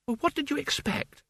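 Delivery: snapped. A narrator's reading. Isabel retorts to Mort about Albert, high snap. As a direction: snap back in a high, thin-volumed voice. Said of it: "snapped Isabel".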